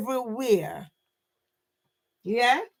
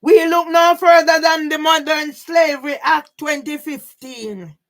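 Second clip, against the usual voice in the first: shouting; 3 semitones higher, 8 dB louder than usual. Real, not acted. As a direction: proclaim it to the crowd. Ranting declaration about slavery.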